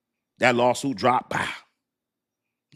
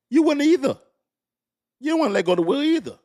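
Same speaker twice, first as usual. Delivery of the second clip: much higher, louder challenge; loud call-out; 14 semitones higher, 5 dB louder, faster than usual. Real, not acted. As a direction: jab it at them loud. Calling out the listener.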